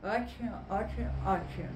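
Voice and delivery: words forced through a pen held in the teeth; pen in mouth. Shown as a demonstration. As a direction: say it with a pen between your teeth, forcing a smile. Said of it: "Olha o mico que eu tô pagando".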